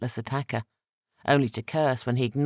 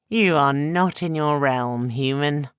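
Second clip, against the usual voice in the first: put-on slow, louder sneering Sandawai accent; 2 semitones higher, 5 dB louder, slower than usual. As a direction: sneer down at the human, slowly. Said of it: "he said with a sneer".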